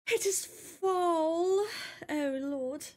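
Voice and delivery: high, slow thrilled announcement; awed delight. Real, not acted. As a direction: draw it out in wonder. Autumn arrives in game.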